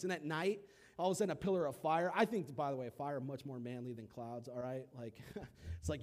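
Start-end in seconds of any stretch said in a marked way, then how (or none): none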